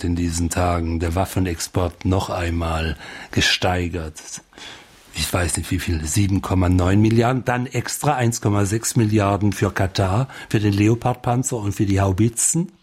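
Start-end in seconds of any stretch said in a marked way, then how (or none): none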